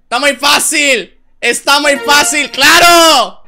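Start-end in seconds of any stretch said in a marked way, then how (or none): 2.5-3.4 loud mocking "claro"; shouted sarcastic claro. Real, not acted.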